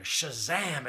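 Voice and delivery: loud imitated exclamation; sudden loud, punchy cry; called out. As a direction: call it out across the campsite. Imitating a morning cry from camp.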